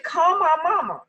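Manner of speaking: high, loud, crying plea for mom; childish wail for mama; whiny plea. Retold or imitated. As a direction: beg like a little kid, near tears. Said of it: "grown woman me, talking about".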